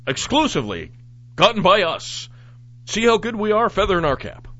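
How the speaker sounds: smug, self-congratulating mock newsreader; mocking news boast. Host mocking the news station, smug boast. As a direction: puff yourself up like a bragging newscaster. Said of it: "I love how they pimp themselves".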